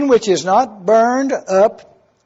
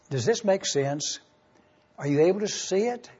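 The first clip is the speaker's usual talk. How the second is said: soft check-in; 10 dB quieter than usual. Real, not acted. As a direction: ask it softly, almost to yourself. Pastor checking in softly.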